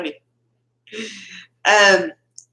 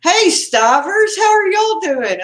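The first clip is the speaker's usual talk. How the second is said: excited greeting; 9 semitones higher, 4 dB louder than usual. Real, not acted. Host welcomes friends joining her live, excited. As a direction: greet them high and thrilled.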